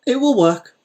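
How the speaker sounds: high, firm assurance; confident. A narrator's reading. Old mender assuring the landowner, high and firm.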